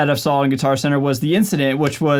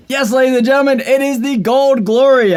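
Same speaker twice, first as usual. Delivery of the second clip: excited big reveal; 10 semitones higher, 5 dB louder than usual. Real, not acted. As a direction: announce the guitar like a showman. Proud reveal of new guitar.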